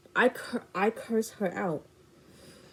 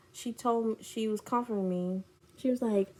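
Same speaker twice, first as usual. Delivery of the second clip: ordinary delivery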